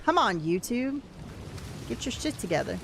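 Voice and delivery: ordinary talk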